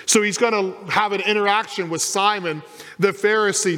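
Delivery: ordinary talk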